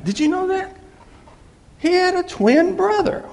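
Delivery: high, surprised trivia aside; excited aside. Real, not acted. Preacher springing a fun fact on the congregation, high. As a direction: share the trivia with a bright lift. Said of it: "trivia aside".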